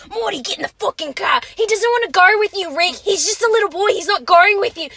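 loud, high mock yelling; mock shouting. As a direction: yell it like an angry cartoon mom. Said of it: "Don't you remember that one?".

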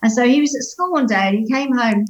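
ordinary talk

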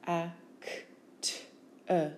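F-A-C-T-er as separate phonemes; sound by sound. Shown as a demonstration. Teacher showing phonemes, F-A-C-T-er sound by sound. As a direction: sound out F-A-C-T-er with gaps.

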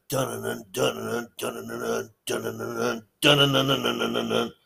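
vocalizing a thumping club beat; beatbox the club music, half-joking; mock club beat. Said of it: "at the club going".

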